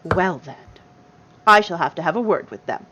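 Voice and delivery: lightly voiced, indignant old gnome; prim gnome grandmother. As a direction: politely scold like an offended granny.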